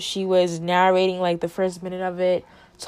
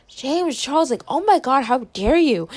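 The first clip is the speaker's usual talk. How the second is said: high, indignant gasp; scandalized how dare you; outraged excitement; 5 semitones higher, 3 dB louder than usual. Retold or imitated. Reacting to the Tati video.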